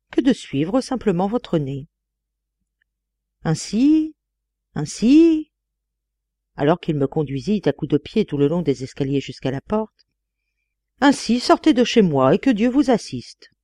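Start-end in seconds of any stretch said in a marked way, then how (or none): 3.4-5.5 high, rising cry on each word; emphatic exclamation. A narrator's reading. Father kicking his son downstairs, emphatic. 11.0-13.5 high-pitched, emphatic send-off; emphatic dismissal. A narrator's reading. Father kicking his son out, emphatic dismissal.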